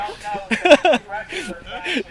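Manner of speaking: soft fit of giggles; helpless little laugh. Real, not acted. Rally-goer losing her train of thought on camera, giggling.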